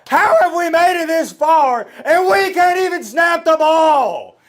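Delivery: furious high shouting rant, slowed; rage monster. Real, not acted. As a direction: blow up at the center in full fury. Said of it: "I turned into a rage monster".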